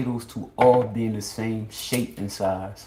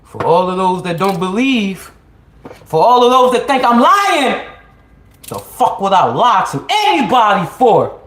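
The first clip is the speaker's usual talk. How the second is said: defiant; 10 semitones higher, 10 dB louder than usual. Real, not acted. Defiant rant at doubters.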